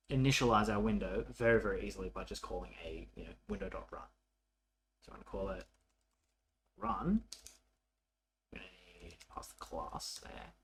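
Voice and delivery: ordinary delivery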